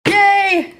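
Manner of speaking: high, loud cheer of joy; excited cheer. Real, not acted. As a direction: squeal it out happily. Thrilled the paint works.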